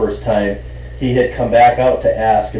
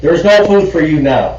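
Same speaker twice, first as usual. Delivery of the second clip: louder, higher mock snooty waiter; high-horse waiter; 6 semitones higher, 5 dB louder than usual. Waiter scolding the big table, mock snooty and louder. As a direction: lord it over the table a little. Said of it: "got up on his high horse".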